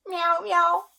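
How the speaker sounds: high loud meowing; cat meow. Acted. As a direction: mew like a cat. Cat sound effect.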